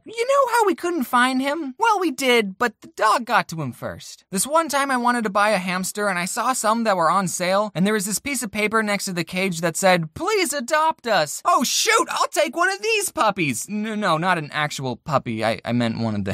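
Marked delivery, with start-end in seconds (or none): 0.0-4.2 mom's voice. Retold or imitated. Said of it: "She said". 10.1-11.4 cute pleading sign. Pretend. Voicing the sign on the cage. 11.4-13.7 excited reaction. Retold or imitated. Retelling own reaction at store.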